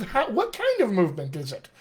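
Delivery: ordinary delivery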